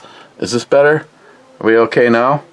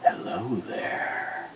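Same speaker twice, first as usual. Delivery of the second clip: a slow breathy whisper of "Hello there"; whispered sexy hello; 2 semitones lower, 15 dB quieter, much slower than usual. Shown as a demonstration. Streamer whispering back to viewer Cobra, seductive. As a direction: breathe "Hello there" slowly, barely voiced. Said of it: "remember I went".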